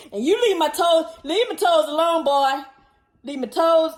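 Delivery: exasperated owner yelling at puppy; loud scolding. Real, not acted. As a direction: snap at the dog to quit it. Dog biting her toes.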